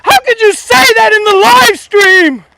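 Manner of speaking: shrill falsetto screech, very high, loud and slow; mock outraged viewer. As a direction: squeal it like an offended busybody, way up high.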